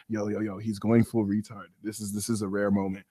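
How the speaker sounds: a lightly voiced Pisces whispering to a friend; mock Pisces voice. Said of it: "Pisces is gonna be like".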